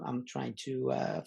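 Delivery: ordinary talk, nothing marked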